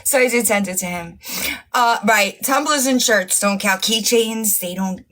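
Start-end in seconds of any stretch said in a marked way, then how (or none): none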